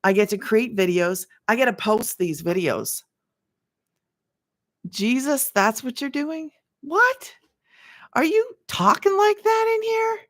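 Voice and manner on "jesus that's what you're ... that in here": high, incredulous exclamations; stunned at what she hears; shocked reaction; surprised. Real, not acted. Reacting to someone's voice.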